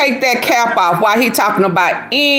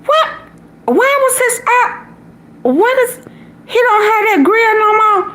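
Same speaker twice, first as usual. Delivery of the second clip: very high squeaky stunned questions; high-pitched bewildered rant; baffled questions. Retold or imitated. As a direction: squeal every question in disbelief. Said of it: "I said".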